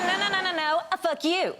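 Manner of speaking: playful mock insult.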